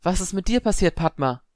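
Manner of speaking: high-pitched, startled question; alarmed concern. A narrator's reading. Prefect asking shaken Padma what happened, alarmed. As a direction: raise the pitch in worried surprise.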